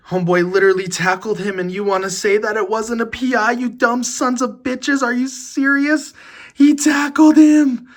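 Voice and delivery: rising to a high, loud outraged yell; furious rant. Real, not acted. Ranting at fans about the missed pass interference, yelling high. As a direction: blow up in disbelief at the call.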